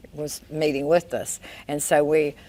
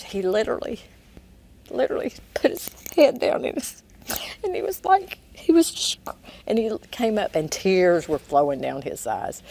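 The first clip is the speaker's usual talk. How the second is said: emotional; 5 semitones higher than usual. Real, not acted. Telling how he broke down.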